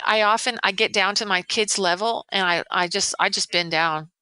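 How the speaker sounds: ordinary talk, nothing marked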